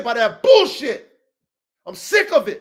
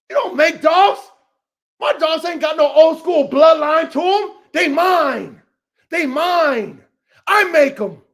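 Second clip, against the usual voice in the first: loud, high heated boasting; real heated shouting; angry boasting shout; 4 semitones higher, 5 dB louder than usual. Real, not acted.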